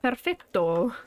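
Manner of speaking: perfetto said with a light Italian accent. Shown as a demonstration. Streamer recalling saying it in Italy, light Italian accent. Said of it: "I used to say perf".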